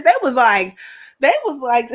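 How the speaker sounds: ordinary talk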